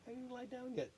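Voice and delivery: ordinary delivery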